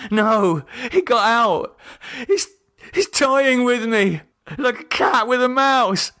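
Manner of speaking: high voice breaking into tears; fearful sobbing. A narrator's reading. David breaking down in fear.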